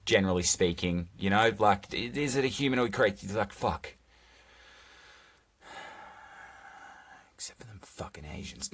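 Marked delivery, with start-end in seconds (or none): 5.6-8.7 whispered mock confession; hushed joke aside. Comedian slipping listeners a joke after silence, hushed. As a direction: drop to a near whisper, much softer.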